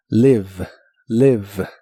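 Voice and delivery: "live" with short i, silent final e; "live" sin la e final; English pronunciation demo. Shown as a demonstration. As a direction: say "live" as lev, no final e sound. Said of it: "la E del final ... casi nunca se pronuncia".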